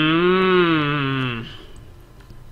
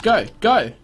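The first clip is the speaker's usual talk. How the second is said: snapping; 6 semitones higher, slower than usual. Real, not acted. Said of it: "I didn't mean to snap".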